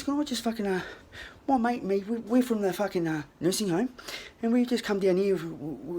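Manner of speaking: put-on high, hesitant old fellow explaining himself; polite old man. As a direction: imitate a courteous old man asking for help. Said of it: "so polite spoken".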